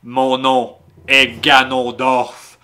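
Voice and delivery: slow theatrical villain menace. Acted. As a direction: drag each word out like a dark lord. Reads villain's lines dramatically.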